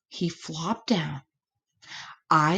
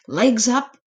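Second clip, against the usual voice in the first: usual speaking voice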